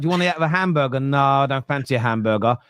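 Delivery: ordinary delivery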